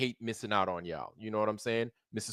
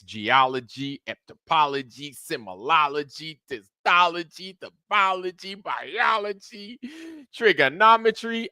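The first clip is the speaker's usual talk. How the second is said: mocking boastful list; 6 semitones higher than usual. Streamer mocking a man's pile of claimed degrees, exaggerated climbing list. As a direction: pitch climbing steadily with each item.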